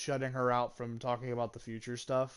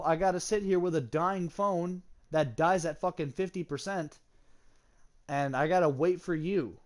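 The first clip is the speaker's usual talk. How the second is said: retold exasperated comeback, higher pitched; exasperated retort; 5 semitones higher, 4 dB louder than usual. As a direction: argue back, punching the key words higher. Retold argument with the ex.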